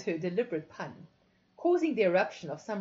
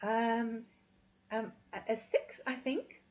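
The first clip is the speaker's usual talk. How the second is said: stammering; 3 semitones higher than usual. A narrator's reading. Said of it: "I stammered".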